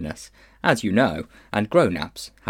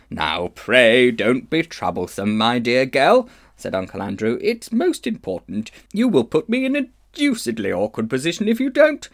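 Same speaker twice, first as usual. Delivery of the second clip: pompous pleading uncle; 2 semitones higher, 4 dB louder than usual. Acted. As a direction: bluster to get the money. Uncle Andrew pleading for money.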